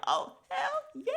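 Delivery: high squealing oh hell yeah, slow; excited squeal. Retold or imitated. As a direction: squeal with delight at the idea. Reacting to second crying baby.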